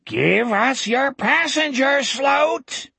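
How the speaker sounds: high, drawn-out taunting howl; slow, high-pitched monster cry; howling creature. Acted. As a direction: howl the demand from outside. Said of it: "howling voice from outside screamed".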